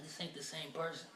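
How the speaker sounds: ordinary talk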